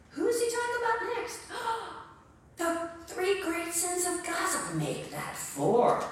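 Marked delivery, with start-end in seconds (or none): 1.4-2.0 a sharp theatrical gasp; staged gasp. Acted. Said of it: "gasp before next nation". 4.7-6.0 a deadpan correction, low and unhurried; low wry aside. Acted.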